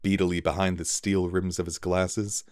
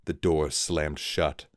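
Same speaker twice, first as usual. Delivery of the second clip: ordinary delivery, nothing marked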